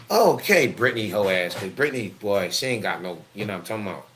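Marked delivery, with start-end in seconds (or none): none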